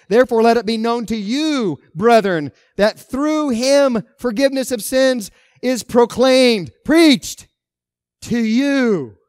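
loud, high preaching voice ringing out; forceful proclamation. Real, not acted. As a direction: proclaim the verse at full force, hitting the key words. Preacher proclaims scripture at full force.

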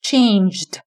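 "changed" with a short d closing it; final d added. Shown as a demonstration. Teacher shows learners the d ending, final d added. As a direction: end "changed" on a light d sound. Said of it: "เติมแค่ d ก็เป็น".

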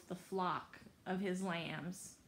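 ordinary delivery

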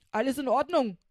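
reporting robber. Acted. Schnapp reporting to the captain, loud brisk report. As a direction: report in like a soldier. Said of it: "meldete Schnapp".

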